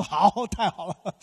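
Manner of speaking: put-on laughing delight at the child's refusal. Father telling his kids, laughing gladly. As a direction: chuckle through the words, pleased.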